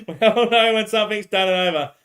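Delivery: laughing it back. Real, not acted. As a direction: laugh the line out again. Repeats line laughing.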